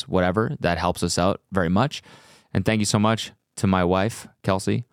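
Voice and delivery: normal talking voice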